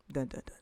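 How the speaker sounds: whispered dun dun dun; mock suspense sting. Building suspense for her friend, a whispered mock sting. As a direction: hum a mock dramatic sting under the breath. Dramatic sound effect in story.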